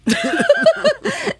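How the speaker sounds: real laugh, very high and loud; cracking up; high squealing laugh. Real, not acted. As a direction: let out a shrieking laugh. Laughing hard.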